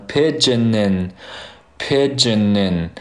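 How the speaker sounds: "pigeon in" run together, the final n carried into "in"; teacher's slow linking demo of "pigeon in"; linked N. Shown as a demonstration. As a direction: slowly link "pigeon in" as one word. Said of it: "前面这个n和后面的in就连接起来了".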